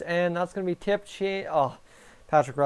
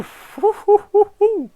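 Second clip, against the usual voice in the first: high, loud burst of nervous laughter; 9 dB louder than usual. Real, not acted. Nervous laugh after close chance.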